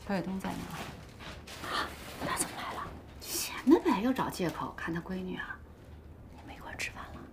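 very soft, half-whispered gossip; hushed aside. Acted. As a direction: keep it under your breath. Women's private aside about the father.

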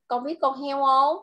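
normal talking voice

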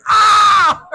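loud, high scream; acted scream at the kids; parent's meltdown scream. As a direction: burst out in a full-voiced yell. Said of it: "losing your mind on your kids".

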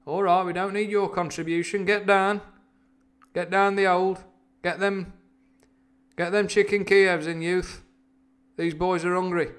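high-pitched, insistent mock order; reader's Uncle David. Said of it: "So he's like".

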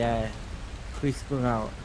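ordinary talk, nothing marked

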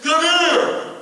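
high, loud call; calling over. Retold or imitated. Old friend calling him over in Burger King, loud call. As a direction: shout him over from across the room.

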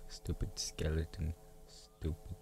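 unvoiced, soft muttered curse at a skeleton; whispered insult. Real, not acted. Gamer whispering insults at a skeleton in Minecraft. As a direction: hiss it under your breath.

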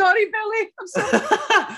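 high-pitched, laughing sorry; helplessly amused, squeaky apology. Real, not acted. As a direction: apologise through real giggles, voice shooting up. Laughing apology to impersonated comedian.

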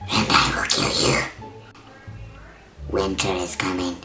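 normal talking voice

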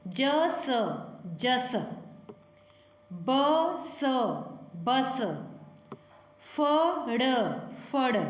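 ਜ, ਸ as separate drawn sounds, then blended ਜਸ; each letter's sound alone with a pause, then the word; sounding out letters. Shown as a demonstration. Teacher shows children how to read by letter sounds, sounding out. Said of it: "ਅੱਖਰਾਂ ਦੀ ਆਵਾਜ਼ ਦੇ ਨਾਲ ਪੜ੍ਹਨੀ ਹੈ".

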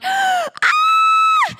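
high-pitched delighted scream; excited shriek. Acted. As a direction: shriek like an overexcited relative at the door. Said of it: "they just get so excited".

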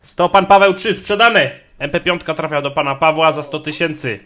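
bang the gavel and shout sold; auctioneer. Acted. Closing the sale.

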